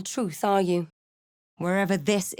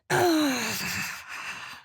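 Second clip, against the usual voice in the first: 1 crying sigh; 6 semitones higher than usual. Acted. Wordless sad sigh.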